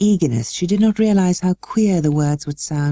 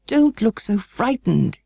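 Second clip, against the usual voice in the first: a bit higher, slow, urgent reassurance; exclaimed; 6 semitones higher, slower than usual. A narrator's reading. The guardian exclaiming to Mary, slow and urgent. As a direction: burst out to stop her fear. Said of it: "he exclaimed".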